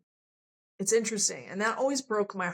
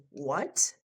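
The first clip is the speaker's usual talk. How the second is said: shocked what; 5 semitones lower than usual. Retold or imitated. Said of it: "He was, like, shocked".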